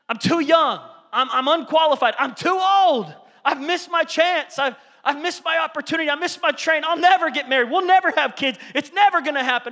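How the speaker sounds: high, loud complaining voice; mock the people making excuses; whiny excuse maker. Preacher mocking believers who whine excuses to Paul.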